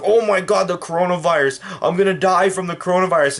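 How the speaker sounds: mocking a burger-eating man panicking over the virus; mock panic. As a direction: squeal like a scared glutton. Mocking fat man fearing virus.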